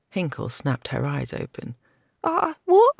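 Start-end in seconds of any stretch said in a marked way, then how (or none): 2.2-2.9 high, loud startled gasp. A narrator's reading. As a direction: jolt awake confused. Girl startled awake.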